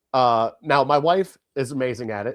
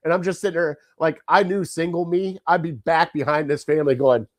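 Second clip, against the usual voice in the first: normal talking voice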